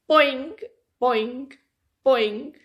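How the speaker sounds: bouncy rising boings; playful springy boings; boing sound. Acted. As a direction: bounce each word up.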